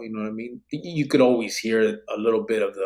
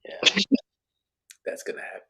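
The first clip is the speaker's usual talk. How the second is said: whisper; 10 semitones higher, faster than usual. Real, not acted. Aside to co-host.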